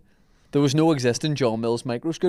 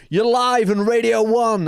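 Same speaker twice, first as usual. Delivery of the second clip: high, drawn-out radio DJ voice; Doctor Fox; 7 semitones higher, 3 dB louder, slower than usual. Retold or imitated. Prank caller posing as a radio DJ, mock presenter voice. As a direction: put on a big slow live-on-air voice. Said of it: "pretend to be Doctor Fox on Radio One".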